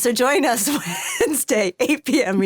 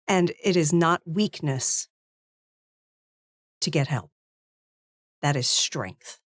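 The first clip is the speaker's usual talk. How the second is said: solemn heartfelt outro; 2 semitones lower than usual. Real, not acted. Wife closing the stream on mental health, solemn and measured.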